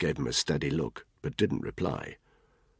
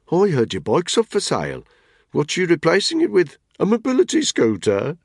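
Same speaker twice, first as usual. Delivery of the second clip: higher, louder bloke ribbing a mate; Tony's mocking voice; 13 semitones higher, 6 dB louder than usual. Tony teases Spud about his bike, mocking and raised. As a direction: needle him with a grin.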